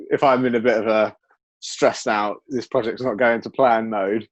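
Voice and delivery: ordinary talk, nothing marked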